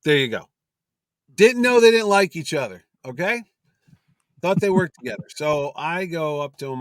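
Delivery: ordinary talk, nothing marked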